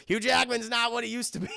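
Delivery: ordinary delivery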